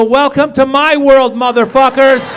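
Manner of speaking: slow, loud, savoring taunt; gloating. Retold or imitated. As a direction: drag it out with satisfaction. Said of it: "I go".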